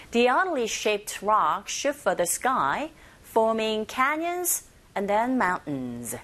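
slow model with "oddly" as AH-dly; teacher slowly models it for practice; slow drill line. Shown as a demonstration. Teacher drills the sentence slowly for listeners to repeat. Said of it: "Give it a shot in the sentence".

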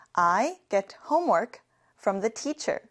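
I get homework from the teacher, said slowly and evenly; slow repeat-after-me. Shown as a demonstration. Teacher leads class repetition, very slow and clear. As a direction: model it slowly for the class to copy. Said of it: "같이 해볼게요".

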